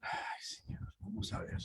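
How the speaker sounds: soft unvoiced whisper to herself; whispered aside. Real, not acted. Reader fixing the volume, whispering to herself. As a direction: breathe it under your breath.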